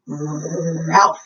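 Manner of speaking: plain reading voice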